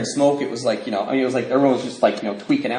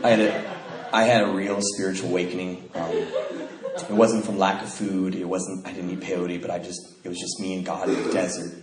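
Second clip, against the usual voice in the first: low, hushed solemn testimony; put-on spiritual; 6 semitones lower, 7 dB quieter than usual. Young man claiming an awakening in the desert, mock spiritual. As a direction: share it softly, with feigned reverence. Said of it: "supposed to talk like this is spiritual".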